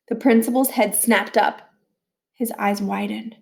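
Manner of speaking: usual speaking voice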